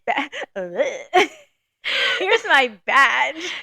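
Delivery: slow laughing badge flash; laughing mock cop. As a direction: laugh through a fake cop line.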